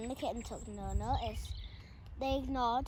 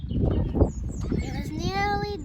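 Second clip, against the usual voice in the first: higher, louder, very slow build; slow suspense; 9 semitones higher, 10 dB louder, much slower than usual. A narrator's reading. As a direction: drag it out for suspense. Suspense in narration.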